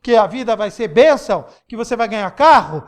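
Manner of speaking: normal talking voice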